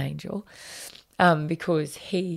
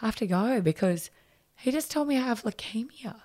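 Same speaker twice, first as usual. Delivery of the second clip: retold tearful admission, voice lifting high; higher pitch, as if starting to break down; 6 semitones higher than usual. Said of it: "I sort of broke down".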